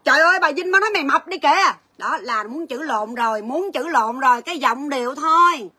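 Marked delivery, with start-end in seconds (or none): 0.0-1.7 higher and louder gossip that starts a fight; hammed-up troublemaker; provoking loud tone. Shown as a demonstration. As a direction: blurt it out to stir trouble. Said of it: "Nó khác mấy chị".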